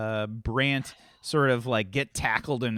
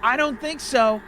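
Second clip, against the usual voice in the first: indignant ranting crank; 10 semitones higher, 5 dB louder than usual. Acted. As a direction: cry out like a persecuted street preacher. Plays ranting street crank.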